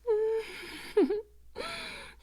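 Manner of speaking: high, loud predatory laugh; delighted laugh. Acted. Vampire delights in the heartbeat.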